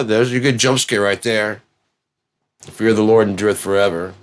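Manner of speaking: ordinary talk, nothing marked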